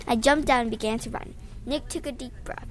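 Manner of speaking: plain reading voice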